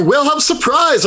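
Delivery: high, sing-out hero's catchphrase yell; signature battle yell. Acted. Hero popping out of the water to stab, yelling high. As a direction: pitch it way up like an ambush cry. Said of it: "apparently that's what I yell now".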